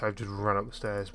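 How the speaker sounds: usual speaking voice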